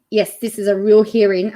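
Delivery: usual speaking voice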